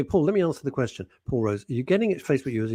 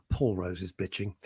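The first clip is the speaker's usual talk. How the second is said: normal talking voice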